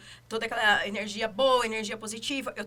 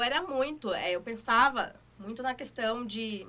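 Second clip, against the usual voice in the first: ordinary talk, nothing marked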